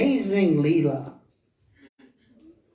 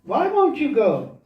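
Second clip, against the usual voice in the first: raised pitch, pressing him to go; insistent urging; 5 semitones higher than usual. Acted. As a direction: push him harder with each phrase. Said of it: "keeps pushing him".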